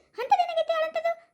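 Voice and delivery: laughing high-pitched what happened; laughing disbelief. Acted. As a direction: scoff in amused shock. Hears the aunt was crying.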